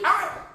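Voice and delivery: high, sharp ow; squeaky acted ouch; mock pain yelp. Acting out the strained oblique.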